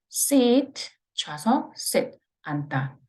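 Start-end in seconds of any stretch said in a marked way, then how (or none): 0.1-0.9 seat with a long drawn ee vowel. Shown as a demonstration. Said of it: "이거는 길게". 1.8-2.2 sit with a short clipped i. Shown as a demonstration. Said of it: "얘는 짧게".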